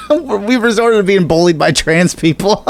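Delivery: laughing through the words; can barely get it out for laughing. Real, not acted. Laughing at the tweet.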